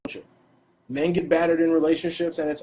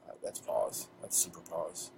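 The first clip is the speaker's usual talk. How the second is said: whisper; 8 semitones lower, 14 dB quieter than usual. Pretend. Joking whispered aside.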